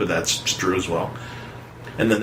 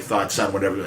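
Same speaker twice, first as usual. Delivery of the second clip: ordinary talk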